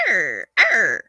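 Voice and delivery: mock pirate grumble, tired; playful pirate snarl; grouchy pirate growl. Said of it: "I turn into a pirate when I don't get sleep".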